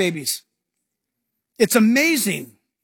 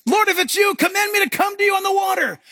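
hammed-up eager Peter; 10 semitones higher than usual. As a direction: beg to be called onto the water. Peter voiced calling out.

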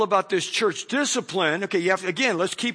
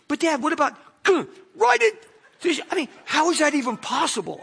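daughter's voice; 8 semitones higher, faster than usual. Retold or imitated. Daughter pokes the cut again.

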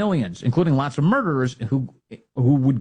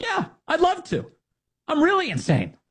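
mock socialist guest. Host mocks socialist guest, high sarcastic voice. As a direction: play the eager guest, pitched way up. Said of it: "they're like".

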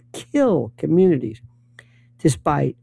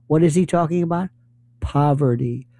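usual speaking voice

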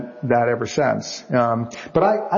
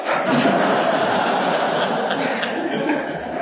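a voiceless giggle, pitched way up; high breathy laugh; 13 semitones higher than usual. Real, not acted. Author laughing about the boastful stranger. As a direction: laugh under the breath in disbelief.

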